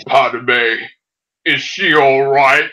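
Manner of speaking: low, slow mock Vader voice; a deep, heavy masked villain; Darth Vader impression. Mocking the prequel scene in a low slow Vader voice. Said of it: "it's like".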